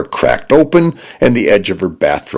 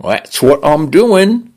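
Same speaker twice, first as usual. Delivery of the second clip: Cockney-leaning British; 11 semitones higher than usual. Acted. Condo's British voice answers.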